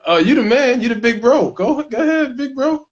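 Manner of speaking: high-pitched, loud playful insistence; jokey high-voiced hype; playful deferring. Real, not acted. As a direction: pitch it way up, egging him on. Playfully defers the question to co-host.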